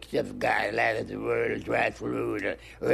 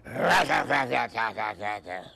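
gunfire noise; 3 semitones lower than usual. Pretend. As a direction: mouth machine-gun rattle. Cannon shooting Peter down.

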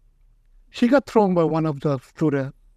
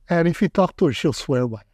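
ordinary talk, nothing marked

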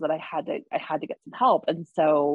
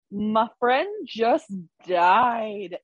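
slow, higher, pointed retort; retorting to upline; 5 semitones higher, much slower than usual. Retold or imitated. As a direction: spell it out slowly and pointedly. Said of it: "I was like".

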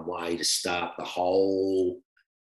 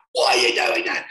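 loud, breathy mock yell of exasperation; hammed-up shout of annoyance; mock exasperated shout; 4 semitones higher, 6 dB louder than usual. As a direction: imitate a fed-up teammate shouting at the prank. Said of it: "Bernie ended up going".

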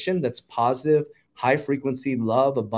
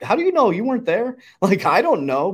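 incredulous retort; 9 semitones higher, 5 dB louder than usual. Retold or imitated. Retorts to a doubter.

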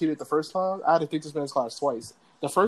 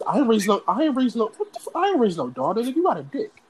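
imitating an outraged dad, higher and louder; mock scolding; 7 semitones higher, 4 dB louder than usual. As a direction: stammer into a disbelieving telling-off. Acting out angry Dominican dad's scolding.

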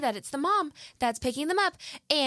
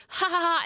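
mock cackle; 7 semitones higher, 3 dB louder than usual. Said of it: "cackling during this whole thing".

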